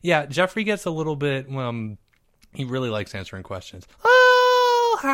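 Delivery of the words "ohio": high, loud wailing "Ohio"; wailing, cry-like shout of Ohio. Pretend.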